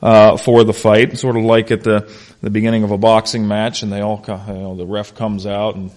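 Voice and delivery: usual speaking voice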